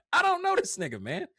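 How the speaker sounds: high, loud comic protest; mock disbelief. Viewer disowning the unfamiliar live streamer, mock disbelief.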